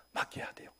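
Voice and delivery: whispered urging. Real, not acted. Pastor urging, echoed softly.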